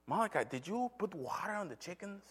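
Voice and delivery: retold parental check, high and soft; questioning father. Father questions son in retold scene.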